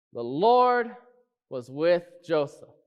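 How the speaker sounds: preacher leaning on "Lord"; stress on Lord. Shown as a demonstration. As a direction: hit "Lord" hard, then ease into "was with Joseph". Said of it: "What am I trying to say?".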